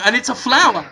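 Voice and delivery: ordinary talk, nothing marked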